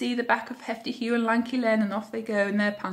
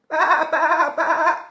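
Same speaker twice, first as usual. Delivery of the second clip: three loud, high wobbly baas; sheep bleats; 6 semitones higher, 7 dB louder, slower than usual. A narrator's reading. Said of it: "said the fat red hen".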